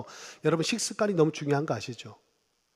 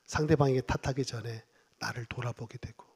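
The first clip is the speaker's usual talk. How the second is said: normal talking voice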